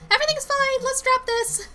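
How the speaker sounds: very high, tight-lipped denial; evasive Lindsay. Acted. Coworker wanting to drop the subject, high and insistent. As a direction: shut it down in a high voice.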